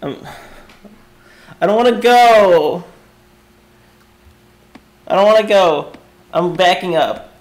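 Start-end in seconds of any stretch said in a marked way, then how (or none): 1.6-5.9 reluctant wail. Real, not acted. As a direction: plead not to go on. Dreads walking further on.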